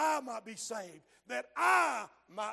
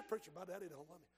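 sad quick reply; 5 semitones lower, 12 dB quieter, much faster than usual. Retold or imitated. Preacher voices a listener's reply.

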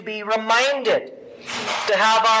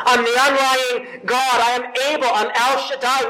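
loud, higher, commanding declaration; voice of God; 4 semitones higher, 5 dB louder than usual. Acted. God declares his power to Abram, loud and commanding. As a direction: proclaim it with divine certainty. God's promise voiced by preacher.

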